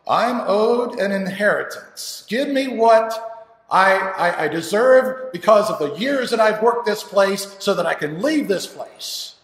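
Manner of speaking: slow, high, loud demand; furious, drawn-out shouting; demanding son. Acted. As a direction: demand what you're owed at full voice. Son demands his inheritance.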